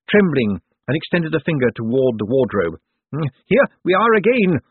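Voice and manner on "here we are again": high, loud possessed shriek; scream. A narrator's reading. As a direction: scream it out as the spirit takes hold. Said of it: "Bent screamed".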